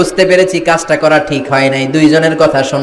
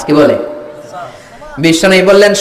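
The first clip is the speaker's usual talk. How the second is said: usual speaking voice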